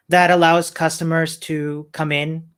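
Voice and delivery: normal talking voice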